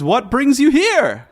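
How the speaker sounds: high, loud, slow, unnatural; Volo voice. Acted. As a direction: ask it in an oddly high, slow voice.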